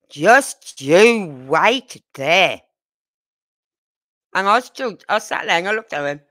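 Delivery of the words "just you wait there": very slow, drawn-out, loud warning; granddaughter mimicking a mum. Three-year-old warning her brother, slow mum-like scolding. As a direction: stretch out each word like a scolding mum. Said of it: "The way she said it".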